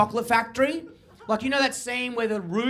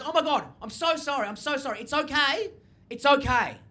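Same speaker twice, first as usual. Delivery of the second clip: higher, hushed mock apology; apologetic shop lady; 3 semitones higher, 4 dB quieter than usual. Shop lady apologises to offended customer, imitated soft reassurance. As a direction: gush softly, then settle into reassurance.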